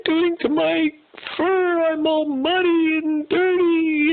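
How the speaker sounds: high, slow, whiny sobbing zebra voice; overly dramatic zebra. Acted. Zebra stuck in the mud wailing to himself, hammed-up sobbing. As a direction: wail like a hammed-up crybaby zebra. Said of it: "screaming and crying and going on and on".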